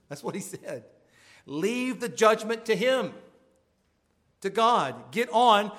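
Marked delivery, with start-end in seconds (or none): 1.4-5.7 a hint of the old Welsh preacher, louder and slow; mild imitation of a stern preacher ordering you on; stern Lloyd-Jones. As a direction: bark it out as the doctor would, slowly. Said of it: "You can hear that".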